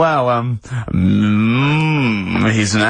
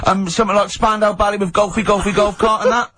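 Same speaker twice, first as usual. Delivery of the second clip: eager caller pitch; 8 semitones higher than usual. Retold or imitated. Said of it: "caller pitching song".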